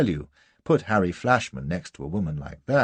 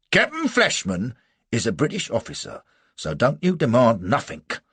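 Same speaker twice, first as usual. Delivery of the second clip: loud, raised soldier's voice; rebuking sergeant; 5 semitones higher, 6 dB louder than usual. A narrator's reading. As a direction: put the foreigner in his place.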